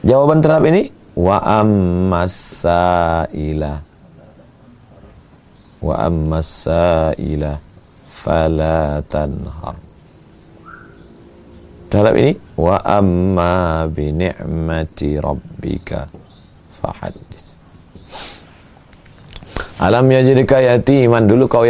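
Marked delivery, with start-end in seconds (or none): 1.1-9.8 slow, low, drawn-out chanted verse; slow Quran recitation. Acted. Preacher reciting the verse to the congregation, slow and chanted. 12.6-17.5 slow, low, drawn-out chanted verse; slow Quran recitation. Acted. Preacher reciting the verse to the congregation, slow and chanted.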